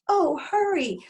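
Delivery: high, loud plea to hurry; urgent pleading. Acted. Dragon to father cutting the rope, high urgent plea. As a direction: beg your rescuer from up high in the voice. Dragon keeps urging his rescuer.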